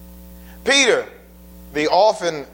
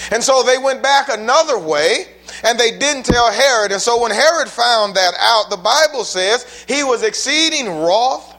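louder and higher, building preacher's fire; preaching at full force; 4 semitones higher, 4 dB louder than usual. Acted. Preacher telling the congregation of Herod's slaughter, rising fervent preaching. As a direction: swell it up like a revival sermon.

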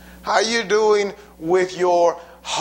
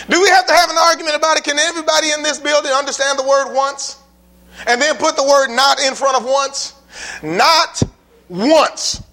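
preaching loud; 6 semitones higher, 3 dB louder than usual. Real, not acted. Preacher hammering a point.

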